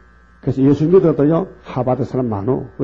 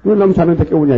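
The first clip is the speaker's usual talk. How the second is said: mock scolding; 4 semitones higher, 5 dB louder than usual. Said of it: "막 야단쳐".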